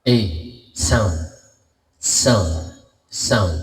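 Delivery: loud mic check with hall reverb. Shown as a demonstration. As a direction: say it into a big echoing hall. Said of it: "Ito yung meron".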